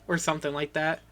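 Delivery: normal talking voice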